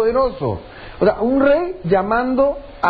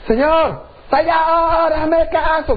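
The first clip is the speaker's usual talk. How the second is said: high, sustained cry for attention; desperate calling out; 7 semitones higher, slower than usual. Acted. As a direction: call out to God like hailing someone. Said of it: "está llamándole la atención a Dios". (clamando a gritos)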